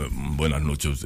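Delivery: low, pompous anchorman greeting; pompous newsreader voice. Retold or imitated. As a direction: puff up the voice like an old TV anchor. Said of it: "y todos engolaban".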